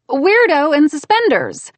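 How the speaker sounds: bright high scolding woman; heroine voice. A narrator's reading.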